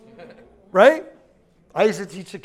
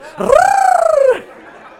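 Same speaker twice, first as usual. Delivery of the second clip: long, loud high rolled-R trill; tongue trill; 8 dB louder than usual. Shown as a demonstration. Preacher shows what to practice alone in the car, trilling loudly. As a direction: sustain a high tongue trill at full volume. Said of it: "Just when you're in the car".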